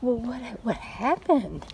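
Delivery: usual speaking voice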